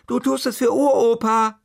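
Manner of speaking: high, loud call from the cellar; mother calling up from the cellar, firm; mother calling from afar. Acted. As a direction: toss it back up the stairs. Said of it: "drang ihre Stimme noch gedämpft nach oben".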